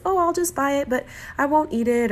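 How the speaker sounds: high, airy voice of temptation; the little voice that justifies; tempting inner voice. Retold or imitated. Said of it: "that voice that says".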